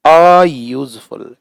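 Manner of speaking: teacher spells अयूजफुल out slowly; slow syllable drill. Shown as a demonstration. Teacher drilling the word for students, slow. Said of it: "यूजफुल का य".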